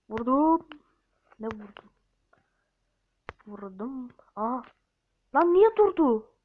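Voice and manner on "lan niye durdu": sudden high, loud cry of disbelief; why did it stop, yelled; startled yell. Real, not acted. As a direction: shout at the screen. Player yells at stuck character.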